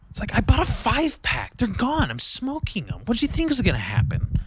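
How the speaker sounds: high, incredulous rant; exasperated. Acted. Ranting at his girlfriend, exasperated and high. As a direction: throw up your hands.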